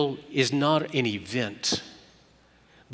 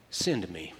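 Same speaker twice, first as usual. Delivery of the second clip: soft willing reply; 8 semitones lower, 9 dB quieter than usual. Acted.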